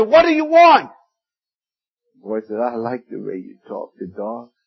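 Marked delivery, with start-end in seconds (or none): 0.0-0.9 much higher and louder, fed-up shout; exasperated shout. Retold or imitated. Father yelling at his son while training the dog. Father yells at interrupting boy. 2.6-4.5 softer and lower, a small quiet reproach; quiet rebuke. Retold or imitated. Little boy quietly rebuking his father.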